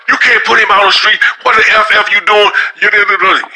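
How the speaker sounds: high-pitched outraged protest, pitch jumped way up; union rep yelling. Retold or imitated. Union steward objecting to firing, indignant high-pitched outburst. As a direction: play the union guy blowing up in disbelief. Union guy jumps up, going off.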